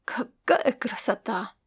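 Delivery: admiring hushed whisper; tender whisper. A narrator's reading. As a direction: breathe it out in delight. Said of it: "с умилением разглядывая".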